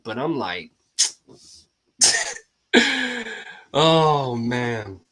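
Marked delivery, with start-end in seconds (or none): none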